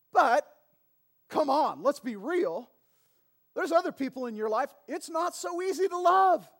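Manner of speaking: rising preacher fervor, calling out the room; full-force preaching. Acted. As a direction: preach it loud and high, building. Preaching build.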